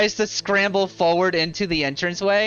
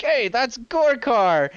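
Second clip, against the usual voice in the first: higher, drawn-out fan call; excited bystander; 5 semitones higher, slower than usual. Acted. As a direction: call out in delighted recognition. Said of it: "You hear one person like".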